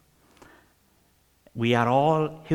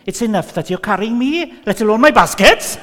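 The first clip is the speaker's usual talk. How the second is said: put-on much higher and louder little old lady; old Welsh lady; 10 semitones higher, 9 dB louder than usual. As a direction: protest sweetly at the kindness. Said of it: "And she turned to him and said".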